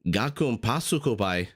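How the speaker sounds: careful try at "Gakun pasukhobai", hard G, stress on the first word; repeating Georgian. Shown as a demonstration. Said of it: "Kakun pasukhobai".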